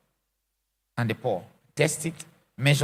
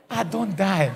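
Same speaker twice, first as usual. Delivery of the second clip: normal talking voice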